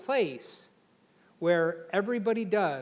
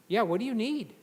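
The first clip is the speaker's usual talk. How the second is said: normal talking voice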